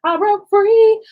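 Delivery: high, loud triumphant shout; proud playful yell; mock triumph. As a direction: belt it out like a victory cry. Mock triumphant echo of friend.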